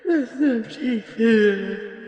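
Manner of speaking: slow, half laugh, half sob; laughing through tears. Acted. Witness sobbing with laughter that the teeth are gone. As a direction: relief cracking into tears.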